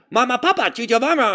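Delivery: high cartoonish character voice; silly character voice; crazy cartoon character. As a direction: squeal it like a cartoon. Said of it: "I'd do a bunch of crazy characters".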